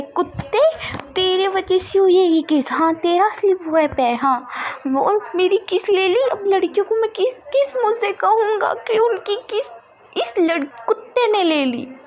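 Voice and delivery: very high, indignant whine; whiny angry boy. A narrator's reading. Wing blaming Vam ji for the kiss, high whiny voice.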